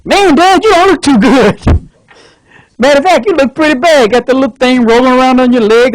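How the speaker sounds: overeager son at bedside. Acted. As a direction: talk your sick dad up with forced pep.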